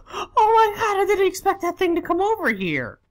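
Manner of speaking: high, loud cry of disbelief; real high-pitched alarm, easing slightly at the end; shocked wail. Real, not acted. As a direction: wail it, still rattled by the surprise. Shaken by unexpected enemy.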